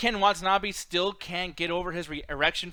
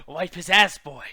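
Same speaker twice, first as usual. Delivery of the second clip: mock Japanese accent with a country touch; loud hammed-up accent mash-up; 6 dB louder, slower than usual. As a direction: belt it out in a silly blended Japanese-country accent.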